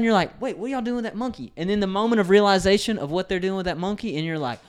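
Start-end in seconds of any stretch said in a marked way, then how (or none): 0.4-1.5 high, fast sudden panic; mock alarm. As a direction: snap from delight to alarm. Voicing a diner suddenly alarmed.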